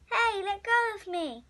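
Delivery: ordinary delivery, nothing marked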